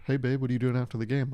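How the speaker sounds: ordinary talk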